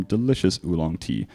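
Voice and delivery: normal talking voice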